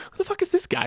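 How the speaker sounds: high squeaky outraged voice; mock outrage. Reeve watching the movie, squeaky mock outrage. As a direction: play a baffled star in a cartoon squeak.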